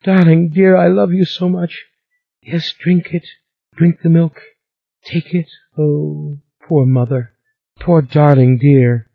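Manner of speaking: high, tearful cooing; high tearful coo of a giant girl; crying voice. A narrator's reading. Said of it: "said the crying voice".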